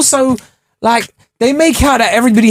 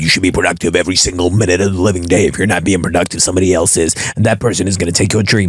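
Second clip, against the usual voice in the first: lowered sneering hustle-bro lecture; mocking online hustle guru; 11 semitones lower than usual. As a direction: parody the grind-culture influencer, deep and droning. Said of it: "which is what these guys online will have you believe".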